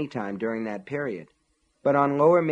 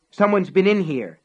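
loud, higher anguished cry; mind crying out; 8 semitones higher, 9 dB louder than usual. A narrator's reading. Man discovering his toy ruined, crying out. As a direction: cry it out inside his head. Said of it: "his mind cried".

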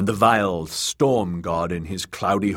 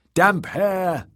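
high chanted shout; high, eager acclamation; crowd cry; 13 semitones higher, 3 dB louder than usual. Acted. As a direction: cry the name like a cheering crowd. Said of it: "they cried".

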